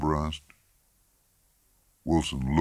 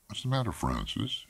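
higher, softer questioning; lightly voiced wife, pitched up and quiet; concerned wife; 7 semitones higher, 6 dB quieter than usual. A narrator's reading. Wife asking her husband what is wrong, softly probing.

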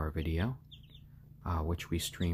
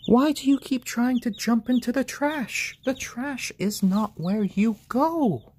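high pet talk; 5 dB louder than usual. Real, not acted. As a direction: gently scold a silly chicken. Scolding chicken in pet voice.

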